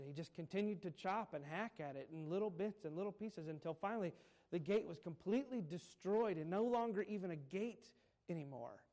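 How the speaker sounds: usual speaking voice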